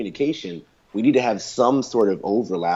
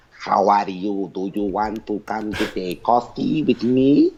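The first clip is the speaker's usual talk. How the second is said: slow, careful, stilted learner English; mock non-native speaker asking for coffee; stiff foreign accent; slower than usual. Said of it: "they speak like".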